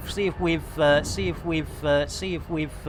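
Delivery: stuck record. Pretend. Streamer fakes a stuck record while flying. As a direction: loop it like a skipping record. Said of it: "it's blooming record stuck again".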